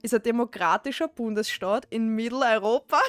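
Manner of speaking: amused Austrian dialect reading. Shown as a demonstration. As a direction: read aloud in broad Austrian dialect. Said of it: "when you read it out loud".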